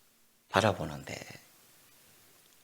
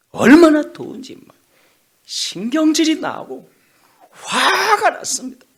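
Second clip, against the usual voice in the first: much higher and louder, slowed, building fury; rising anger; 13 semitones higher, 11 dB louder than usual. Acted. Preacher voicing Jonah's hot angry frustration. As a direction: let the heat boil into rage.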